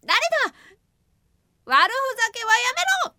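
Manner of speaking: high, loud indignant yell. Acted. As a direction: yell at the unseen attacker. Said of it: "とわめくと". (高く大声でわめく)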